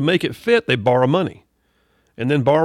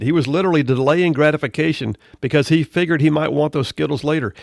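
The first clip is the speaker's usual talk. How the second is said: ordinary talk, nothing marked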